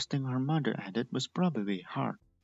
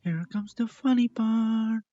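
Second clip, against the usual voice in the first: slow, higher and louder scheming; gleeful inner thought; 10 semitones higher, 10 dB louder, slower than usual. Acted. Candy thinking to herself before the prank, slow and gleeful. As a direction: relish the prank about to start. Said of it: "Candy thought".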